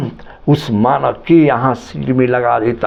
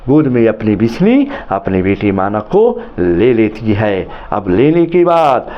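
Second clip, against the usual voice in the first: ordinary talk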